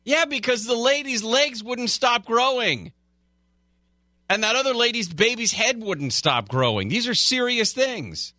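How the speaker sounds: high, outraged squeal; high indignant. Acted. Mock outrage over TLC shows.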